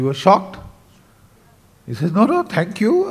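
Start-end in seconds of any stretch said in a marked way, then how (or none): 2.1-3.1 higher-pitched, polite refusal; embarrassed. Retold or imitated. Shocked traveller refuses the boy's tray, embarrassed. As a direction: decline awkwardly, imitating an embarrassed guest. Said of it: "he was embarrassed".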